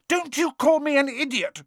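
high, wounded retort; a small boy bristling at the insult; indignant Neville. A narrator's reading. Neville snapping back at Ron, high and indignant.

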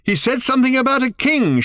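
high, loud shout. A narrator's reading. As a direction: yell back, holding on. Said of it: "shouted Arthur in return".

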